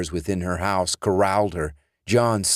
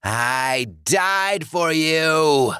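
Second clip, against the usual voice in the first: much higher and louder, slow furious roar; raging undead John; 10 semitones higher, 4 dB louder, much slower than usual. Acted. Dead John raging at Alice, furious roar. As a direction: roar it in fury. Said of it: "John raged".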